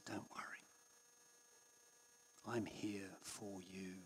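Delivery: breathy, very soft whisper, slowly; tender whisper. Retold or imitated. Jesus whispering comfort to his anxious child. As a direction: breathe the comfort right into their ear. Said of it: "I think that's the tone of voice".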